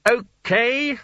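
high, self-satisfied okay. A narrator's reading. Sconner has got the wizards inside, satisfied. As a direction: say it high, pleased with yourself. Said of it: "said Sconner with some satisfaction".

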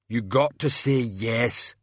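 slow, ponderous simpleton; heavy, dim-witted brute voice; Banjo. A narrator's reading. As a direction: insist slowly, stretching each word. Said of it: "Banjo rumbled".

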